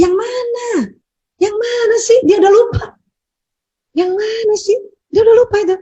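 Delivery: high, puzzled mock husband voice. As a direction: play the clueless husband who forgot. Husband mimicked forgetting the incident.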